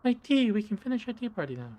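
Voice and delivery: put-on high, sweet little girl doll voice; a doll's squeaky childlike voice; creepy talking doll. Doll voice returns.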